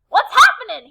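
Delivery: loud, high blaring shout; Tacky barging in with a blaring shout; blared. Acted. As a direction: blast it out like a foghorn. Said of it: "he blared".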